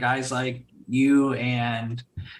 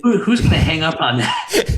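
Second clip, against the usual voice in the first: high, loud laughing question; 5 semitones higher, 8 dB louder than usual. Real, not acted. Laughing to his host about English call centers, high and loud. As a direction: laugh through the words in delight.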